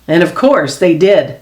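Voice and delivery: usual speaking voice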